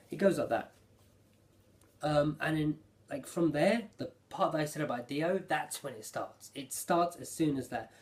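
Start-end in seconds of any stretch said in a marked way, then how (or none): none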